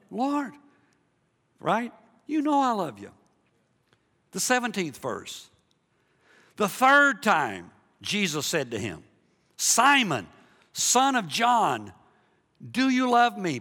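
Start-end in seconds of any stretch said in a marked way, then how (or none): 0.1-1.9 high-pitched protesting reply; raised, pleading pitch; mock-pained Peter. Acts Peter answering Jesus again. 2.3-3.1 high-pitched insistent reply; acted protest, pitched way up; protesting Peter. Acts Peter answering Jesus again. 9.6-13.6 slow, loud, high-pitched challenge; drawn-out, insistent question; solemn questioning. Acted. Acts Jesus's third, pointed question.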